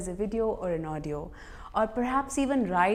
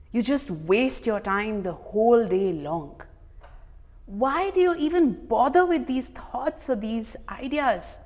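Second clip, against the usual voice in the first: acted scolding with raised questions; inner critic; 3 semitones higher, 3 dB louder than usual. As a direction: berate your mirror self like a harsh critic. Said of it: "take up the role of my inner critic".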